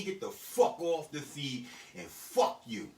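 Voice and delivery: ordinary delivery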